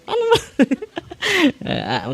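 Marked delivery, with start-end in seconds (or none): 0.0-0.4 squeaky high mocking demon voice; demon taunt. Priest mimicking the possessed in a high taunting falsetto. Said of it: "Nag-ana siya". 0.5-1.6 high laugh. Real, not acted. Laughing at demon impression.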